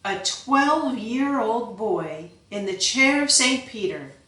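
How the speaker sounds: a lord urging his son to burn for God; impassioned father. Acted. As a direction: rouse the boy like a crusader.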